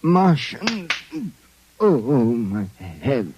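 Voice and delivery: low, slow, dazed waking; groggy groan. Acted. Drugged detective waking up dazed, low groggy groan. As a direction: come to with a pounding head.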